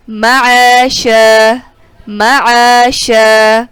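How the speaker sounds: مَعَاشًا with the a after ع held long; alif madd. Shown as a demonstration. Teacher demonstrating the alif madd in مَعَاشًا. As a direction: stretch the alif in مَعَاشًا, slow chant. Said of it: "الف مدہ".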